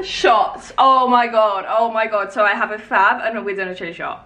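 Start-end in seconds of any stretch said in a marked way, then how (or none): none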